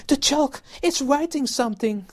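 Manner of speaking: hysterical scream. Acted. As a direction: scream in terror at the moving chalk. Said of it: "screamed Nigel".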